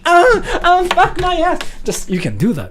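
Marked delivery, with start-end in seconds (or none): 0.0-1.6 high, loud mock scream; wild yell. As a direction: scream it like a madman. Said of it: "go like".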